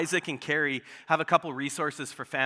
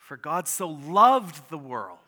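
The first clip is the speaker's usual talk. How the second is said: "loved" pushed louder with a falling tune, slow pace; stress on LOVED; 6 dB louder, slower than usual. Shown as a demonstration. Pastor modeling slow verse meditation, pressing on "loved". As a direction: press into "loved" and let it ring. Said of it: "emphasize a different word each time you read it".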